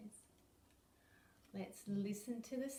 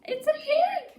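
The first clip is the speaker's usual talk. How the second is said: squeaky; 15 dB louder, slower than usual. Acted. Playful reveal of the pig.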